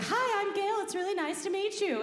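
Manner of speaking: high, sing-out perky service greeting; customer service voice. Retold or imitated. Greeting the new cook at work, customer service voice. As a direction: pitch it way up, customer-service polite. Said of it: "the customer service voice".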